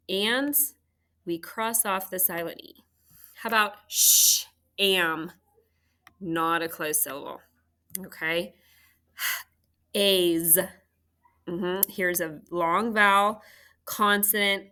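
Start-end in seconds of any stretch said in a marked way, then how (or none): 3.9-5.3 sh-am split into long "shhh", then "am"; sounding out. Shown as a demonstration. Teacher checks for a closed syllable, sounding out. 9.9-10.6 A's led in by a separate breathed "h"; sounding out. Shown as a demonstration. Teacher looks for a long vowel, sounding out.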